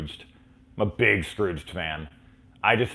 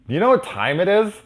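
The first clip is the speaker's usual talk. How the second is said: much higher, louder awkward question; nervous kid question; 12 semitones higher, 7 dB louder than usual. Retold or imitated. Brother to Robert Englund, high nervous blurt. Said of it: "my brother's like".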